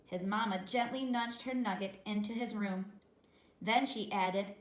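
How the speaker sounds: ordinary delivery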